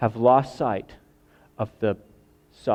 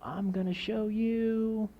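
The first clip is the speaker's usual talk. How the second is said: high, soft, slow squeaky ant voice; mock-tiny threat; 9 semitones higher, 9 dB quieter, slower than usual. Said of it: "an ant threatening an elephant".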